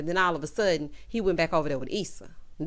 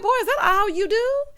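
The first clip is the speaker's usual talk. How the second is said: exasperated yell; 10 semitones higher than usual. Retold or imitated. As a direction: yell at him like a fed-up auntie. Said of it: "I'm like".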